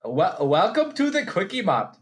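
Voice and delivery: mock Indian shopkeeper accent; bad Indian accent. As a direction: do a poor Indian accent, half laughing. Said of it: "very good Indian accent".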